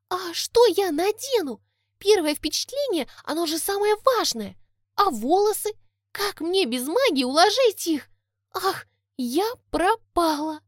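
put-on high, flustered fairy girl; cartoon princess. A narrator's reading. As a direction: panic about being unready. Tori frets about looks.

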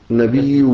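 نبي said nabiiw, ending in a w-u glide; original waw ending. Shown as a demonstration. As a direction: sound out نبي with a final waw. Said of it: "يعني نون باء ياء واو".

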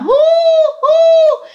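long, high, loud "hoo"; ghostly hooting howl. Retold or imitated. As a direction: hoot like a taunting ghost, drawn out and high. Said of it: "Nó không phải là cười mà nó hú".

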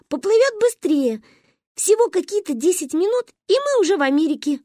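put-on high-pitched child explaining a grand plan; eager little girl. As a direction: pitch it up like an excited kid. Excited child explains her plan. (увлечённая девочка, высоким голосом)

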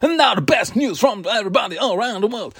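high, hammed-up radio announcer; nineties radio DJ. Host mocking his own intro, nineties radio DJ voice. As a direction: parody a DJ announcing big news. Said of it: "sound like a radio D J from the nineties".

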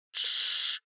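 voiceless hushing shh; whispered shush. Acted. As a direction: hush her with a finger to lips. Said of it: "приставляет палец ко рту".

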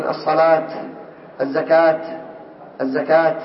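"الصلاة" and "الزكاة" ending in t: as-salat, az-zakat; final ة as t. Shown as a demonstration. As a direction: stop on "الصلاة، الزكاة" with audible t. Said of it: "يقفون عليها بماذا؟ بالتاء".

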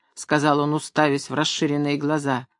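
ordinary delivery

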